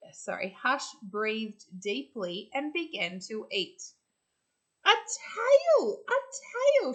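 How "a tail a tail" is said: much higher and louder, thrilled; excited shout. Acted. Both possums shouting at once as the tail appears, thrilled. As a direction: squeal it with delight. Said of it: "shouted both possums at once".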